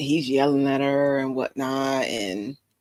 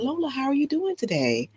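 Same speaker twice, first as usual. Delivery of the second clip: sweet gentle voice; 10 semitones higher than usual. Retold or imitated. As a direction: coo it sweetly to a little girl. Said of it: "That's what you sound like to her".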